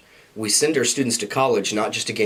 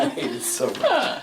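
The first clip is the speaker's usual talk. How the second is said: real laugh through the words; laughing protest; 12 semitones higher than usual. Real, not acted. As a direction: laugh it off, embarrassed. Laughs off praise of his speech.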